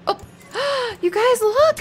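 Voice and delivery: high, loud gasp of delight; excited gasp. Real, not acted. Excited streamer shows viewers a rare flag. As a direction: gasp and call everyone over to look. Sees the secret society flag.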